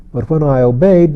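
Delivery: plain reading voice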